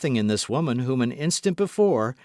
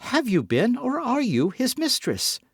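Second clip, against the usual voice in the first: with energy; 13 semitones higher than usual. A narrator's reading. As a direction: insist on the truth. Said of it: "with an energy".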